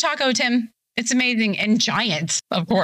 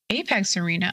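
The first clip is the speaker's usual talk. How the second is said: ordinary delivery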